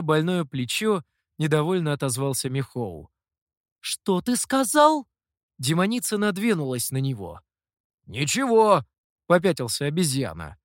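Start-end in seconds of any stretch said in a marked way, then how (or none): 3.8-5.1 high, slow menacing question. A narrator's reading. Demoness advances menacingly. 8.1-8.8 cowed. A narrator's reading. Monkey backs away.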